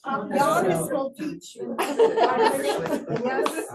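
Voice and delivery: laughing. Real, not acted. Laughing after the joke.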